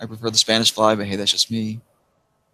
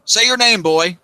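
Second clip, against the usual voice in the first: teasing uncle; 10 semitones higher, 5 dB louder than usual. Retold or imitated. Uncle teasing about speech impediment.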